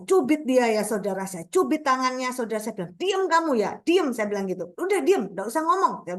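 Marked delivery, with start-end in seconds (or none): 3.0-4.1 higher-pitched mock telling-off; retold motherly reprimand, pitch raised; retold scolding. Mother scolding child in retelling. 4.7-6.1 higher-pitched mock telling-off; retold motherly reprimand, pitch raised; retold scolding. Mother scolding child in retelling.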